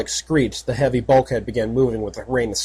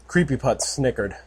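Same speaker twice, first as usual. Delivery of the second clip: usual speaking voice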